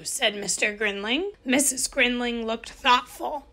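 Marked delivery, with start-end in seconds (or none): none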